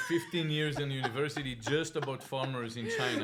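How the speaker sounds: ordinary talk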